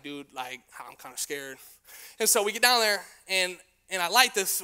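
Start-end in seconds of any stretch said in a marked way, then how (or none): 0.0-1.6 soft, low, nervous confession; teen sneaking up to the firework, hushed and nervous; scared hush. Retold or imitated. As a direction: drop your voice, you're afraid.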